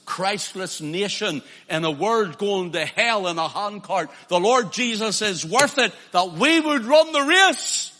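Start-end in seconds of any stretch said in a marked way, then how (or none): 4.3-7.9 loud, high preaching peak; rising to a shout from the pulpit; preaching crescendo. Real, not acted. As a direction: drive it home at full force. Preaching climax.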